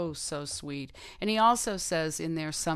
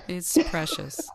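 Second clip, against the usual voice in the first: laughing; 7 semitones higher, 10 dB louder, slower than usual. Real, not acted. Moved by the book passage.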